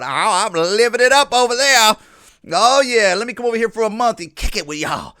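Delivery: mock carefree vacationer; mocking boast. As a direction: mimic someone bragging about living it up. Mocking vacationing asylum seekers.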